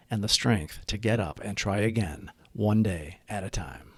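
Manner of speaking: soft, low recited prayer. Acted. Reciting the Serenity Prayer softly to open the reading. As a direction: pray it gently and steadily.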